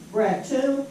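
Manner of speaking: usual speaking voice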